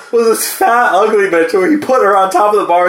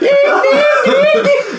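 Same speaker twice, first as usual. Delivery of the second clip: high piercing mock alarm wail; shrill alarm shriek. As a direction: shriek like a smoke detector. Smoke alarm shrieking at cooking.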